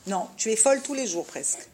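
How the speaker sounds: ordinary delivery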